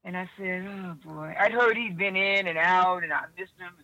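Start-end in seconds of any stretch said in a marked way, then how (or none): 0.7-1.3 a touch of dejection, voice dropping away; quiet, resigned sigh of words; retold despondence. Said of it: "I was kinda getting despondent".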